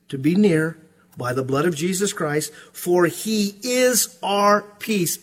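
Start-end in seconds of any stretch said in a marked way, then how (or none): 2.7-5.2 for he is our peace drawn out slowly with weight; preacher stresses the words slowly to the congregation, emphatic. Shown as a demonstration. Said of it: "It's emphatic".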